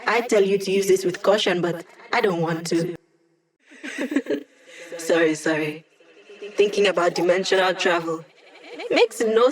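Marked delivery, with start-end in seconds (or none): 3.8-4.4 a quick light giggle, higher; a little laugh at her own joke; short laugh. Acted. Laugh then "Sorry, sorry.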